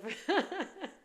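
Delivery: short high-pitched laugh; a little laugh at herself. Real, not acted. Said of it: "I'm laughing".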